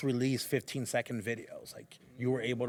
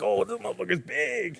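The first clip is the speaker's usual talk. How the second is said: amazed; 8 semitones higher, 4 dB louder than usual. Retold or imitated. Amazed at the man's size.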